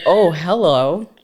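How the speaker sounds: slow sultry oh hello, mock swooning; flirty drawn-out hello. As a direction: drawl the hello like eyeing a hunk. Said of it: "I was just like".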